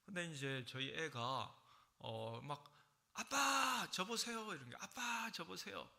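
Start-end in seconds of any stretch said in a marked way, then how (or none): 3.1-4.5 put-on high, eager child's call; child calling dad. 4.8-5.9 put-on high, eager child's call; child calling dad.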